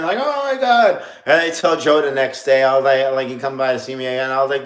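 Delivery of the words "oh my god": high, loud "Oh my God" after falling; panicked exclamation. Retold or imitated. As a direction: cry out in alarm up high. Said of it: "I'm like".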